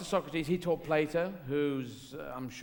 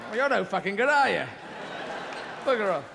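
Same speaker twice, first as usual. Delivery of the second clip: mock exasperated scolding; 4 semitones higher than usual. Owner to Sharky, hammed-up exasperated scolding. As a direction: give the useless shark a piece of your mind.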